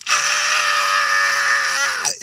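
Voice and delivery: loud drawn-out scream of fright; startled scream. Retold or imitated. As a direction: scream as if grabbed mid-horror-film. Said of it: "he fucking grabbed me and screamed".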